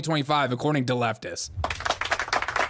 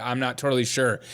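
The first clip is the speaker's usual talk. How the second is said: normal talking voice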